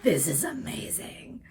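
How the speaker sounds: breathless whisper of delight; whispered awe. Retold or imitated. Editor marvelling at all the gum, whispered awe. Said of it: "he's like".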